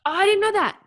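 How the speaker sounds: high, loud burst of surprise; real surprise. Real, not acted. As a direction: blurt it out at unexpected news. Learns the case was dropped.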